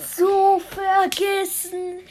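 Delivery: high, loud, drawn-out wail; slow, loud, high exclamation; excited outcry. Real, not acted. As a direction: cry it out in mock dismay. Reacts to remembering Klein Willi.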